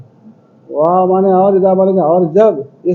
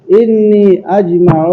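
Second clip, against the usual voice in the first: slow dictation; much slower than usual. Shown as a demonstration. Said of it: "teacher dictating Arabic slowly".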